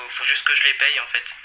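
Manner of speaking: ordinary talk, nothing marked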